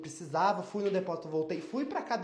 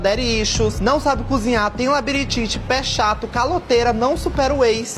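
loud mocking roast; 4 semitones higher, 14 dB louder than usual.

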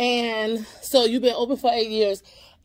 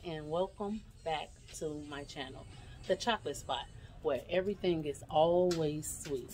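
kind of whispering; 11 dB quieter than usual. Real, not acted. Vlogger to viewers, not disturbing other customers, half-whispering. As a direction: keep it down so others aren't disturbed. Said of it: "I'm kinda whispering".

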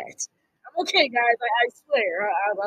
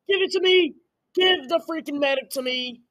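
ordinary talk, nothing marked

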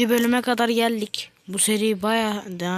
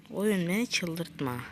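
muttered plea; 2 semitones lower, 9 dB quieter, slower than usual. Real, not acted. Repeats line, softer second.

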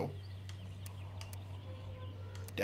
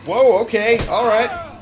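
panicked shouting; 12 semitones higher, 4 dB louder, slower than usual. Real, not acted. Fighting a fast enemy.

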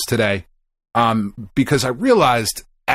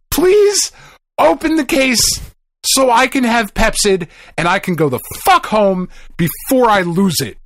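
high, loud exasperated pleading; yelling please at a store clerk, desperate; retold yell; 10 semitones higher, 3 dB louder than usual. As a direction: plead at the top of your voice, close to snapping. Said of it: "and I'm not yelling at you".

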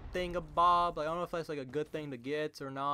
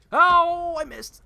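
long, high, loud wail falling away; dismayed wail; 14 dB louder, much slower than usual. Real, not acted. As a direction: cry out at a botched shot. Missed a shot.

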